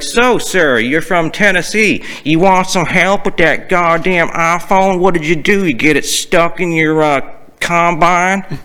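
high, hammed-up Southern drawl; mock the caller in their own Southern accent. Support rep mocking a Tennessee caller, fake Southern accent. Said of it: "to mock someone's accent".

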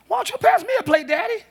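high-pitched imitation of a sassy little girl; young daughter. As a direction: mimic a small daughter answering Daddy back. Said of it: "My daughter tell me".

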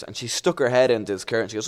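usual speaking voice

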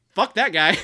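loud, emphatic dismissal; mock outrage. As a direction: blurt it out in comic disgust. Comic outburst about the author.

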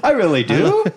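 high Irish lilt with every line rising like a question; sincere words made into questions, mock Irish; Irish accent. Friend keeps the bit going, questioning Irish lilt. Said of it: "Irish accent".

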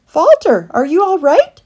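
high, loud anxious call. Acted. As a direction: shout after a vanished friend. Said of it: "called Christopher".

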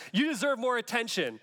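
lightly hammed-up online advice-giver; mock social media advice. Mocks a social media post.

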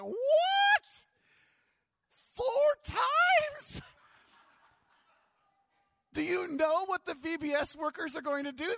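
mother shrieking in disbelief, then fretting; shocked mom. Retold or imitated. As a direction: play the horrified mom scolding her burnt son. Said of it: "And she's like".

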